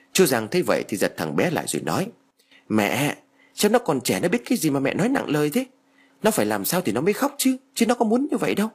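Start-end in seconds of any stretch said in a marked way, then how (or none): 2.7-8.7 high-pitched, climbing remonstration; pleading protest. A narrator's reading. Son defending his child to his mother, pleading protest. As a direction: protest with rising pitch, urgently.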